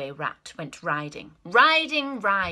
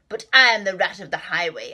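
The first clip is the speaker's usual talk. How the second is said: rat ordering; 9 dB louder, faster than usual. Acted. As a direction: boss them around like a bandit. Rat's refrain.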